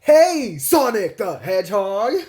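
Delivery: loud, hammed-up cartoon greeting; Knuckles. Knuckles meeting Sonic, loud cartoon hail. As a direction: call out big with a rising finish. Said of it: "He goes like".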